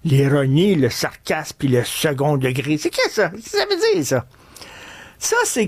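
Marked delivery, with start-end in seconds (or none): 2.8-4.2 high mock puzzled listener asking. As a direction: squeak out the audience's confusion. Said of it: "Là, je vous entends".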